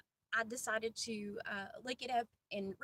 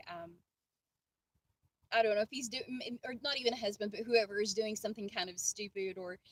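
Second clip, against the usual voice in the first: ordinary talk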